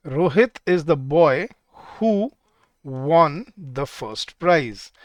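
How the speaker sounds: word by word, as if writing it out; slow dictation. Shown as a demonstration. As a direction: read out slowly with long pauses. Dictates sentence while writing it.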